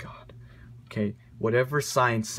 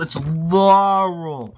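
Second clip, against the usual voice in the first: It's Laurel drawn out slowly and louder; slow emphatic insistence; 3 semitones higher, 5 dB louder, much slower than usual. Acted. Ranter lays down the answer to the internet, slow and insistent. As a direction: lean hard on every syllable of Laurel.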